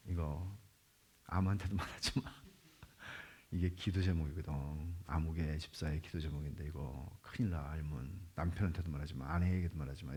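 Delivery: hushed gossip voice; secretive hush. Retold or imitated. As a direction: lean in and keep it secret. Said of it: "이렇게 하는 거예요".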